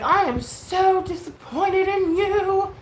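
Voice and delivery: high, loud, drawn-out mocking quote; mock scolding. Streamer laying into the speaker, hammed-up disappointment. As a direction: imitate her in an overblown singsong.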